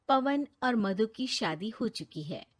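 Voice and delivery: ordinary delivery, nothing marked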